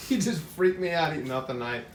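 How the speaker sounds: laughing through the words. Real, not acted. Host laughing at his friend eating off the knife. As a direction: chuckle while telling it, half shocked.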